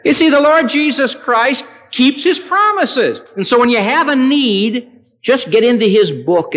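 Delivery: high, loud revival cry; fervent preacher proclamation; preaching at full force. Acted. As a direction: proclaim it with fire. Climactic pulpit preaching.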